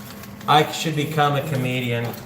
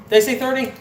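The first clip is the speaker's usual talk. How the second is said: higher, disbelieving "Did I say thirty?" with weight on "I"; surprised; 10 semitones higher, faster than usual. Real, not acted. Streamer realizing he miscounted the bills, surprised. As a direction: catch yourself in a counting slip.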